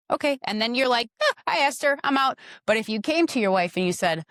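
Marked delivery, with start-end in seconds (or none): 0.1-2.4 mock clueless husband. Wife describes a lazy husband, voicing him higher and breezy. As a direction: play the guy who asked once and quits.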